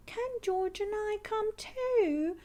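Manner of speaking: put-on high little girl asking; eager Peppa. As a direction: beg to come along. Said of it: "asks Peppa".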